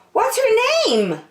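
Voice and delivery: put-on high, eager childlike question; curious young turtle. Franklin at the hospital, high curious voice. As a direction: ask with wonder.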